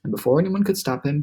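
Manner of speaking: ordinary delivery, nothing marked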